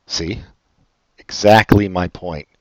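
ordinary talk, nothing marked

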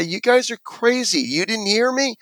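high-pitched retold indignation; indignant defiance. Kid refusing the ambulance crew who want the dog tied, retold indignant defiance. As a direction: protest in disbelief, voice pitched well up.

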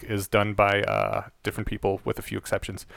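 ordinary talk

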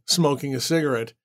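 ordinary delivery, nothing marked

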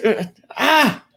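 high playful fighting yelp; mock fight cry. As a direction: yelp like a cartoon brawler. Play fight acted out.

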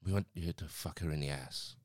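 low, attempted South American accent. Guest making a blunt proposal to the worker, in an attempted South American accent. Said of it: "I'm still doing the accent".

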